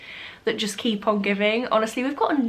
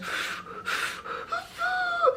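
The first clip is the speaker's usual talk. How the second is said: dreamy sigh; 7 dB quieter than usual. Retold or imitated. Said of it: "looks at the tree and just goes".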